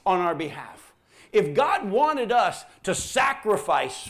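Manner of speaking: loud, high, emphatic pulpit delivery; full-force preaching. Acted. Preacher pressing the congregation about the Son's sacrifice, forceful preaching. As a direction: drive it home to the congregation, drawing out key words.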